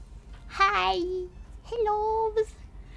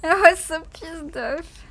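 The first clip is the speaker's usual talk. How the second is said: mock angry, louder; 3 semitones higher, 6 dB louder than usual. Repeats line, louder and slower.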